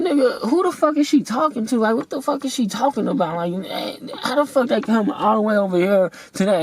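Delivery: incredulous outrage. Retold or imitated. Retelling his reaction to the girl's advice, outraged and high. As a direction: spit out the disbelief, voice up high. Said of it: "I'm like".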